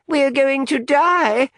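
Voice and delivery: high, slow, panicked wail; frantic. A narrator's reading. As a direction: cry out in sheer panic. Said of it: "added a frantic Natalie".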